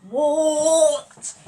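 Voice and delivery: loud, high, drawn-out call back; yelled reply. Retold or imitated. Cousin's brother answers nan from the shower, loud yell. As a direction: holler it back, stretching the word.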